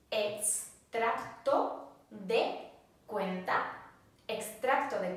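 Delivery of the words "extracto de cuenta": slowed right down, each syllable clear; slow model phrase. Shown as a demonstration. Teacher slowing down so students can repeat. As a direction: spell out Extracto de cuenta slowly for learners. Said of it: "Statement means".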